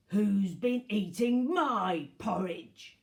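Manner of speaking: loud, slow gruff bear voice; gruff Daddy Bear. A narrator's reading. Daddy Bear finds his porridge eaten, gruff and loud. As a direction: boom it gruffly, stretching the words. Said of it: "in a gruff voice".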